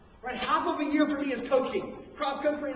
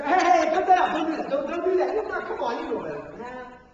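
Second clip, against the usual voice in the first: loud, sharp parental scolding; 5 dB louder than usual. Retold or imitated. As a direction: bark the kids to stop. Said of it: "You say".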